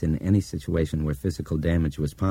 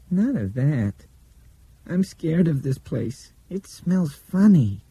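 put-on higher, slow child voice; frightened child voice; 11 semitones higher, 3 dB louder, slower than usual. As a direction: speak slowly as a scared little girl. Blind girl voices her fear.

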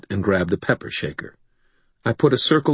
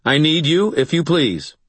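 firm summons; 9 semitones higher, 4 dB louder than usual. Acted. Continues the summons.

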